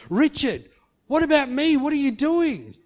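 loud, high reproach; mock scolding call. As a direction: call him out sharply. Imagined scolding God.

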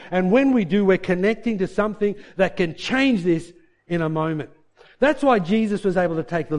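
loud, high, fervent preaching. Acted. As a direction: preach it out to the whole congregation. Preacher building to full force.

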